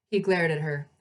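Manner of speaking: ordinary delivery, nothing marked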